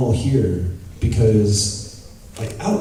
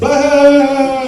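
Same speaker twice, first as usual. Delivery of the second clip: high, loud sung wail; mock emotional explosion; 7 dB louder than usual. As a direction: burst out in a hammed-up howl.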